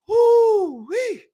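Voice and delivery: high, loud whoop of delight; excited whoop. Real, not acted. Streamer hyping his own music, loud whoop. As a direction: let out a joyful holler.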